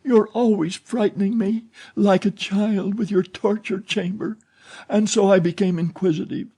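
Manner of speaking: put-on frightened girl pleading against the dark; anguished fear. A narrator's reading. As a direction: beg him in panic, pitched high. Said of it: "Christine's voice of anguish".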